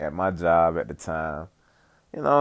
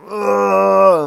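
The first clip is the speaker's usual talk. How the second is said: loud, high grossed-out ugh; disgusted groan; 11 semitones higher, 9 dB louder than usual. Retold or imitated. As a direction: recoil at the smell with a loud ugh. Disgust at straining in bathroom.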